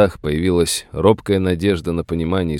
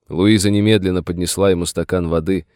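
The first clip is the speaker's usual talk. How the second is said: usual speaking voice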